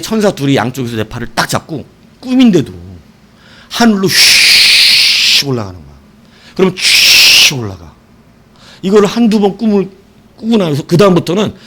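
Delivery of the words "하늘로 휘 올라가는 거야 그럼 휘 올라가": dreamy floating. A narrator's reading. Being lifted up by angels.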